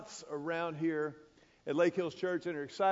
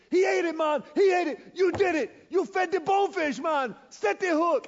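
much higher and louder, bursting with joy; excited shout; 10 semitones higher, 8 dB louder than usual. Retold or imitated. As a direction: yell the news across the flat. Guide erupts when fish bites.

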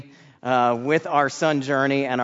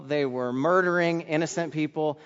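ordinary talk, nothing marked

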